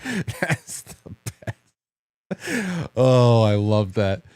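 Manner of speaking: helpless laughter settling into words; real cracking up, then a drawn-out sigh of delight; laughing. Real, not acted. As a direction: catch your breath after a big laugh. Cracking up at own story.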